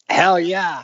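retelling his own eager reply to a friend's offer; eager yes. Retold or imitated. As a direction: snap up the offer with glee. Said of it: "I'm like".

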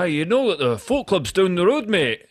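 high, loud Scottish heckle; thick Scottish accent. Retold or imitated. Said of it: "that Scottish accent".

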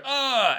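high, sudden mock stab groan; stabbed gasp. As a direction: let out a quick cry as if stabbed in the back. Imitating getting stabbed.

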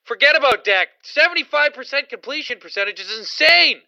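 high, loud, worked-up rant; excited disbelief. Real, not acted. Excited rant about a quarterback's stats.

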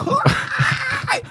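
high squealing burst of laughter; sudden high laughing squeal; shrieking laugh. Real, not acted. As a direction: let out a shrill shriek of a laugh.

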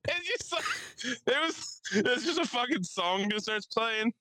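bubbling over with the story; excited. Real, not acted. Laughing high excited telling.

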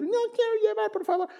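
put-on high-pitched small child's plea; squeaky imitation of a child; pleading little child. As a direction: mimic a little kid begging. Mimics his small daughter pleading.

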